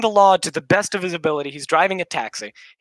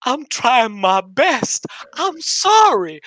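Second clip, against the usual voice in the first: higher, slow, worn-out plea from a tired driver; defeated driver; 7 semitones higher, 4 dB louder, much slower than usual. Retold or imitated. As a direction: beg slowly, like a man who has given up. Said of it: "he goes".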